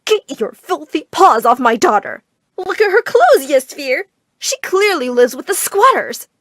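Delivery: loud, emphatic scolding; screeching. A narrator's reading. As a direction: screech in disgust at the intruder. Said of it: "she let out a screech".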